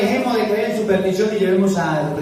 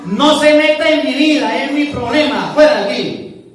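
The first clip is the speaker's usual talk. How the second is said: higher, louder brush-off; annoyed rejection; 6 semitones higher, 3 dB louder than usual. Retold or imitated. Unbeliever telling the preacher to go away, irritated.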